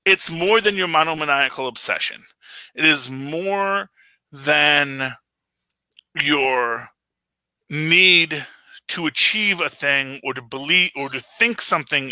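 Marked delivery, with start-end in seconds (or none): 2.7-8.5 slow, word by word with pauses; slow emphasis. Real, not acted. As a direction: drive the point home, spacing out each word. Hammering home the film's message.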